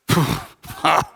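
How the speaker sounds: long mock weary sigh; exasperated sigh. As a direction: let out a put-on sigh of overload. Overwhelmed friend sighs before answering.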